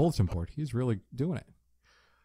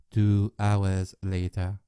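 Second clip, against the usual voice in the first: slow narrator; 4 semitones lower, much slower than usual. Pretend. Mock narrator time card.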